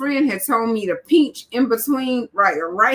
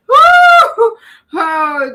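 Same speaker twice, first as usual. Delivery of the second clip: laughing whoop; 12 semitones higher, 9 dB louder than usual. Real, not acted. Bursts out laughing at crying talk.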